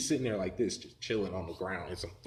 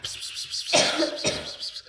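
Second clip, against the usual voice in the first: rapid unvoiced "pss" sounds; whispering snake hisses; 3 dB louder than usual. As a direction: imitate a swarm of hissing serpents. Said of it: "hear more whispers and snakes and serpents".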